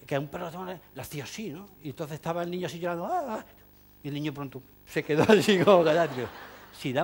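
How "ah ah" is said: mock correcting hiss. Imitating dog trainer hushing child.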